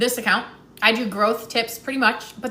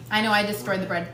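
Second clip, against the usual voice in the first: usual speaking voice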